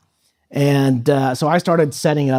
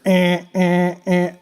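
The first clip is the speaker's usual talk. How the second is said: imitated fire alarm beeps, higher; alarm imitation; 4 semitones higher, much slower than usual. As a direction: mimic a blaring alarm buzz. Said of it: "you just heard".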